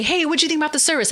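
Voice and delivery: high, fast, bright-eyed hey; friend's excited question at high pitch; eager friend. Retold or imitated. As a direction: rush up all eager. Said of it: "runs up to me".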